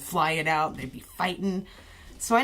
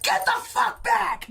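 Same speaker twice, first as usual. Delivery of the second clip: much higher, slow barked order; mock cop shout; 4 dB louder, slower than usual. Cop holding back the crowd, high slow mock shout. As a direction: bellow it, gun drawn. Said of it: "pulls out his gun and is just like".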